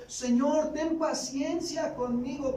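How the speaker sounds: high, begging plea for patience; pleading servant. A narrator's reading. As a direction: beg your master for mercy. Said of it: "le suplicaba diciendo". (súplica de siervo, voz alta)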